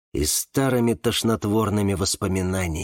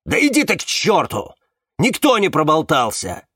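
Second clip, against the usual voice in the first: raised, louder furious retort; angry bark; 5 semitones higher, 4 dB louder than usual. A narrator's reading. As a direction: snap back hotly, pitch up. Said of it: "рявкнул Магит". (сердито рявкнуть)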